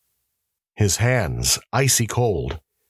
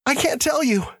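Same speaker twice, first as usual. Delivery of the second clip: much higher, stammering in terror; frightened stammer. Acted. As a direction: stumble over it in fear. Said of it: "stammered the prisoner".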